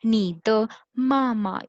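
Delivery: नी द मामा spoken slowly and clearly; slow model sentence. Shown as a demonstration. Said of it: "नीचे next sentence में लिखा हुआ है".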